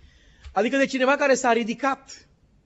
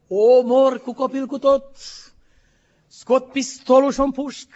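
louder, a raging father's threats, retold; retelling the father's rage; 4 semitones higher, 7 dB louder than usual. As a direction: throw out the threats like the furious father did. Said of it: "Și răgnea răgușit, nebun". (tatăl furios care amenință, redat mai tare)

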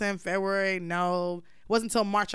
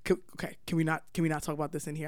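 hushed, softer and fast, half-whispered; imitating a whisper; 6 semitones lower, 5 dB quieter, faster than usual. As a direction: imitate him pleading to keep it down. He begs her to keep quiet.

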